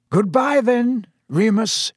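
high, slow, solemn farewell; Dumbledore, sober. A narrator's reading. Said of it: "said Dumbledore soberly".

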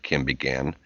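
usual speaking voice

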